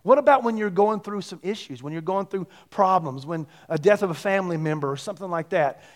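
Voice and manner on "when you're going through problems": ordinary talk, nothing marked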